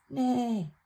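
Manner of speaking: playful mock whinny; horse neigh. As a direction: neigh like a pony for a child. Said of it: "The horse says".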